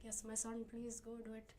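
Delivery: lightly put-on Indian accent. Indian parent encouraging a son, light Indian accent. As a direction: imitate an indulgent parent with a slight Indian accent. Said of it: "I like your Indian accent".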